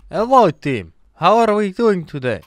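lightly put-on Russian accent. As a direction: greet the team in a Russian accent. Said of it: "The Russian accent actually works".

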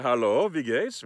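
slow, rising German hello; German greeting. Shown as a demonstration. Slowly greeting a German waiter. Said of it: "first of all, I say".

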